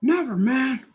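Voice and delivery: high-pitched defiant refusal; heckling critic. Acted. Critic refuses to leave the artist alone, high heckling voice. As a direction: answer back in a squeaky put-on voice. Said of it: "hope that critic leaves me alone".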